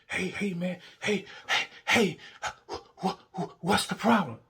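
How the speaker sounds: high, stammering mock-innocent partner; flustered latecomer. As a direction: stumble over the start, pitched well up. Partner runs up late, acted out.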